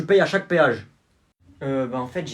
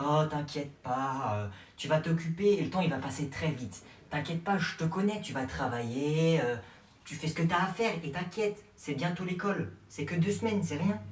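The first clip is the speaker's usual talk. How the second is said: gentle reassurance; 2 semitones lower, 8 dB quieter than usual. Acted. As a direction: soothe her quietly. Mother reassuring softly.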